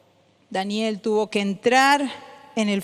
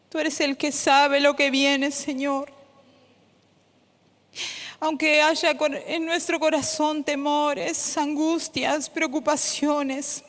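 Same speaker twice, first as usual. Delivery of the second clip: tender prayer; 3 semitones higher than usual. Acted. Prayer softens over music.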